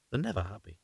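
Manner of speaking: quiet, dry aside under the breath; a sotto voce remark to listeners; muttered aside. Real, not acted. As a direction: drop the voice for a wry comment. Narrator's aside on the wife.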